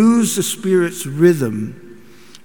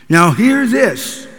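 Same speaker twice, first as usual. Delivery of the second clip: barking orders, higher and louder; drill sergeant; 6 semitones higher, 6 dB louder than usual. Retold or imitated. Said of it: "bark out orders like a drill sergeant".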